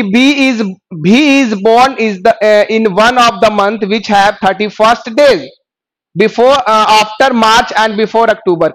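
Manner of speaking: slow, deliberate reading of the English clue. Shown as a demonstration. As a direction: read each word out slowly for the class. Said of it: "reading clue slowly aloud".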